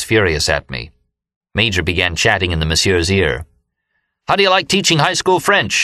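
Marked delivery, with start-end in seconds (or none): 4.3-5.7 yelled. A narrator's reading. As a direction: shout it into the doctor's ear. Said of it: "he yelled".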